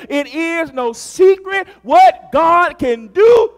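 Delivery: slow, loud, high shout; shouted, drawn-out proclamation; preaching climax. Acted. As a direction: lift the church with it. Sermon climbing to a shout.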